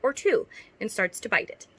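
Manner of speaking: lightly performed read-aloud of a cringey story; reading fanfic aloud. Acted. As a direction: read it like a story you're embarrassed by. Reads her old fanfic aloud.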